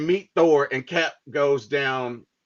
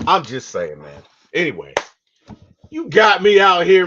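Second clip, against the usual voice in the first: ordinary talk, nothing marked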